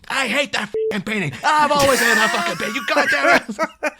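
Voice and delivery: high, loud hammed-up rant; exaggerated outraged shouting; mock furious yelling. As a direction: rage down the phone, comically overdone. Retold furious phone call after prank.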